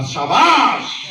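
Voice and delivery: long, drawn-out high shout; shouted praise. Retold or imitated. Mentor hugging the speaker after his stage debut, shouted praise. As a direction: bellow one stretched word of praise. Mentor hugs him and cries bravo.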